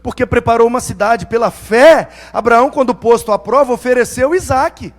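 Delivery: loud, ringing sermon delivery; preaching. Acted. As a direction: proclaim it from the pulpit. Preaching opening scripture.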